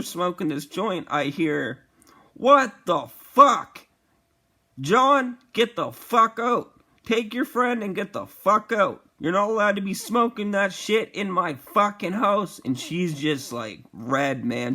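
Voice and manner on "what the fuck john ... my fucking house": loud, high, furious telling-off; retold angry yelling. Parent catches son and friend smoking in the house, angry yell. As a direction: stretch out the opening outburst, then order them out loudly.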